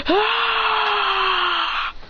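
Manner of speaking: long high strained scream; power-up scream. Acted. Adrian unleashing the fire tornado, screaming. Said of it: "He goes".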